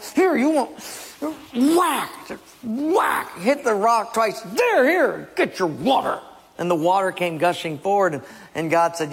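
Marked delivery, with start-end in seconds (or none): 0.0-6.2 angry Moses. Acted. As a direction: strike and spit the words out. Moses striking the rock angrily.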